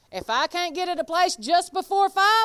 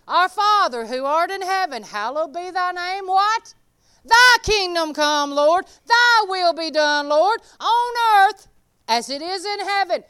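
fervent prayer; 3 semitones higher, 6 dB louder than usual. Acted. Reciting the Lord's Prayer fervently.

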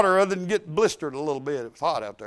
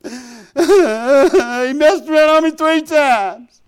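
laughing; 4 semitones higher, 4 dB louder, much slower than usual. Real, not acted. Laughing joke about husband.

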